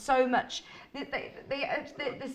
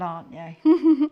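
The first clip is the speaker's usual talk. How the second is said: laughing; 3 semitones higher, 8 dB louder, much slower than usual. Real, not acted. Teasing friend about daredevil plans.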